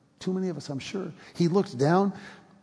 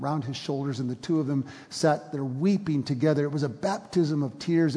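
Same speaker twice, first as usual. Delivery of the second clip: normal talking voice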